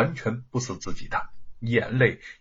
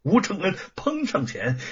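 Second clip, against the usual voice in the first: usual speaking voice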